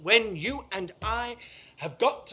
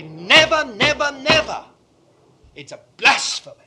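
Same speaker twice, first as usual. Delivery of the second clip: loud, rising never, repeated and hammered; thundering triple never; emphatic denial; 4 semitones higher, 13 dB louder than usual. Real, not acted. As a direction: shout it down as blasphemy. Rejects a false teaching.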